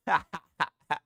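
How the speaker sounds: a short burst of real laughter at a meme. Real, not acted. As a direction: laugh out loud at the joke.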